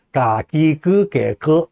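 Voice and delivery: 가기그개거 drawn out with Chinese vowels; slow Chinese-style reading of 가기그개거; Chinese sound. Shown as a demonstration. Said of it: "중국 사람들한테 만약에".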